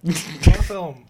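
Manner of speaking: slow, loud exclamation; excited exclamation. Real, not acted. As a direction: savor the praise loudly. Exclaiming about the movie.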